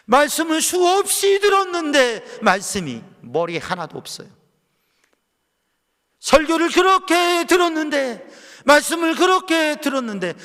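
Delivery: loud, high lamenting sermon voice; exasperated preacher at full force; impassioned preaching. Acted. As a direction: cry out in frustration from the pulpit.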